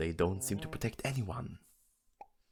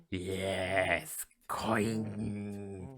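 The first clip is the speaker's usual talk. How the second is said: drawn-out, slow odd voice; lingering put-on murmur; eerie coin talk. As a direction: stretch each word out into a hum. Voicing a talking coin exchange.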